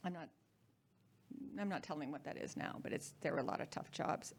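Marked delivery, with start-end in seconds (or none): 1.6-4.3 whispered, softer and lower; a quick hushed aside, a touch faster. Real, not acted. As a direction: drop to a whisper, keeping a secret. Secretive aside about Vietnam.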